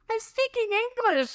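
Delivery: high, slow mock protest; imitated Irish clerk. Clerk correcting the tourist, high drawn-out imitation.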